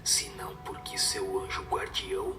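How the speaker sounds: soft, low, unvoiced whisper throughout; whispered spirit's message. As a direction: breathe the spirit's counsel as a hushed whisper. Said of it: "o conselho que deu um espírito superior".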